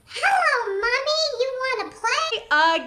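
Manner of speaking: put-on very high, singsong baby-doll voice; creepy talking doll. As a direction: stretch the last words out, high and eerie. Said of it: "and the doll goes".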